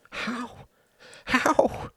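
high, breathy, anguished questioning; distressed question. Acted. Upset man asking his friend for comfort, distressed. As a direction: cry it weakly, then stronger.

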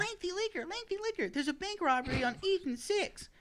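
way up high, hammy dispatcher calling the hero; squeaky pretend citizen calling for help; high mock caller voice. Townsperson calling the tongue hero, high hammed-up shout.

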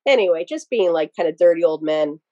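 ordinary talk

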